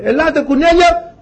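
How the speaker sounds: high-pitched summons, rising at the end; calling out. Acted. Noah summoning animals into the Ark, calling out. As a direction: call the animals over in a high voice.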